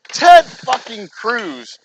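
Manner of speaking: loud, very slow, each word punched; disgusted outburst. Real, not acted. Ranting about a senator to viewers, loud slow disgusted outburst. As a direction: spit out the name in disgust. Angry at politician.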